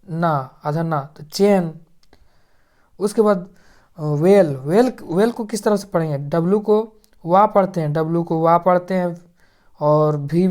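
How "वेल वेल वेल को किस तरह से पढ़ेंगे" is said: ordinary talk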